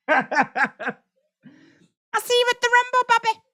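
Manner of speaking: high squeaky mock Brock; Brock impression. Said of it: "That's my Brock impression".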